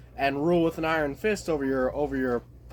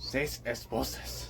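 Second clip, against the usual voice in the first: bad Mexican accent; 4 semitones lower, 8 dB quieter, slower than usual. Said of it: "it's like a horrible accent".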